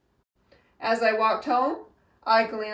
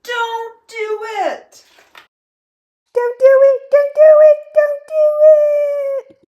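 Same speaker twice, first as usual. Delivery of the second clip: screaming; 15 dB louder than usual. A narrator's reading. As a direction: scream it in rising panic. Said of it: "They screamed".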